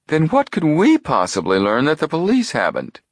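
high-pitched, incredulous question; high-voiced doubter; doubtful protest. A narrator's reading. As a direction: ask it in a high, sceptical character voice. Chet shrugs, doubts the plan.

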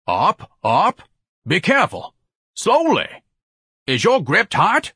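high, loud, anxious commands; imperious supervisor calling out; barked directions. A narrator's reading. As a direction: bark fussy orders at the carriers. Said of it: "Ignatius barked directions as if".

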